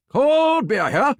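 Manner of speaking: slow, drawn-out high beer call; stadium vendor hawking. Shown as a demonstration. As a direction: stretch it out like a ballpark vendor. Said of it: "Let's hear what you got".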